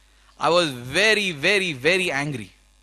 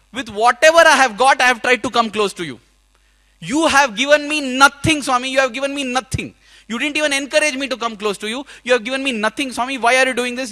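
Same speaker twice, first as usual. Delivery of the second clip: loud, higher, tearful accusation; crying and shouting; 5 semitones higher, 6 dB louder than usual. Retold or imitated. Shouting and crying at Swami, alone in the room. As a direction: hurl the blame, near tears. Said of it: "I was crying and shouting".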